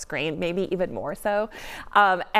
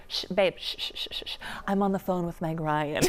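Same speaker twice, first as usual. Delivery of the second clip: put-on hushing a baby; 6 dB quieter than usual. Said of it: "And I was like".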